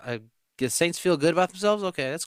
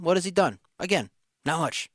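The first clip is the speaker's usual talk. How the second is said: usual speaking voice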